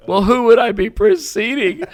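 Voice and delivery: high, loud hammed-up dismay; mock alarm. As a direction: cry it out in exaggerated disbelief. Acts out own alarmed phone questions.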